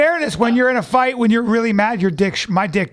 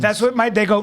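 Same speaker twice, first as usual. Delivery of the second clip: ordinary talk, nothing marked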